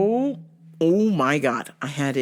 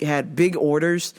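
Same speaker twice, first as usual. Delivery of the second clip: normal talking voice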